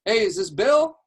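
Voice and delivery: high, loud, upbeat question; friendly cold-call opener. Shown as a demonstration. Coach calls a random agent, high and chummy. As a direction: ask it like you already know him. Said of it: "a certain type of energy that he had".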